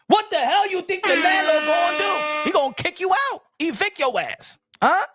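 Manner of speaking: high-pitched, exasperated rhetorical demand; indignant challenge. Acted. Rhetorical landlord question, mock outrage.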